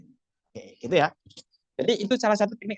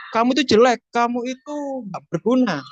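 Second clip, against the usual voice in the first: mock insult; 6 semitones higher, 4 dB louder than usual. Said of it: "Contoh gini".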